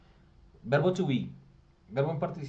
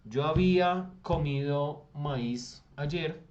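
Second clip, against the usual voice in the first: drawn-out, spoken at half speed; slow example sentence; 4 dB louder, much slower than usual. Shown as a demonstration. Teacher to class dictating the example sentence slowly. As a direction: say each word slowly for the class. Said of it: "Si yo digo".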